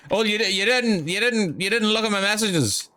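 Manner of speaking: high accusing mom voice; mock worried mother nagging; imitating mom. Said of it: "And she's like".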